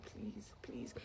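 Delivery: barely audible, voiceless plea; begging. Retold or imitated. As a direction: imitate begging in a faint whisper. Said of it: "I was already begging".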